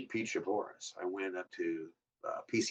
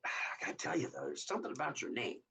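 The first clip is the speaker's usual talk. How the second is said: higher, hushed confiding tone; confiding whisper; 7 semitones higher, 5 dB quieter, faster than usual. Retold or imitated. The boss hinting he knows the name, hushed. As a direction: lean in and share a secret.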